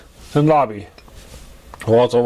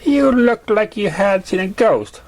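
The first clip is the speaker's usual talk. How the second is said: higher, slower, gleeful teasing; teasing friend pony; 8 semitones higher, slower than usual. A narrator's reading. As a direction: tease him, amused at his fright.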